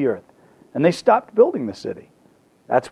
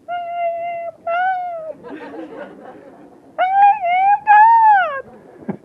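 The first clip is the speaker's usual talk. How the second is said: faint mock yell drifting up from a speck; tiny far-off shout. Creature on the beach heard from heaven, tiny distant voice. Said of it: "not much bigger than the grains of sand".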